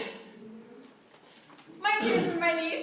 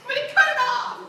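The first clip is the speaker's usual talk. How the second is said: much higher, slow, sing-song wail; overdramatic teen; 11 semitones higher, 8 dB louder, slower than usual. Retold or imitated. Teen misreading a text about her hair, wailing melodramatically. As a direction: wail it like a misread, heartbroken teenager. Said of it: "it's interpreted by her emotions".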